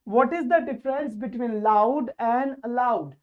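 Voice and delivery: very slow, drawn-out question to the class; slow teacher quiz voice. Shown as a demonstration. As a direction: stretch each word as you ask. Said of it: "poses question to class".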